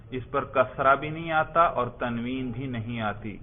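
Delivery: usual speaking voice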